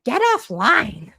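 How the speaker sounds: lightly hammed-up impatient demand, words stretched; imitated order. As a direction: imitate someone calling out an order, drawn out. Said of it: "He's like".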